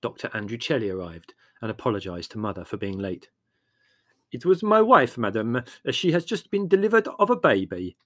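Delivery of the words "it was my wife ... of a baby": higher, louder, proud announcement. A narrator's reading. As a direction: burst out with a new father's pride. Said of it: "he said with pride".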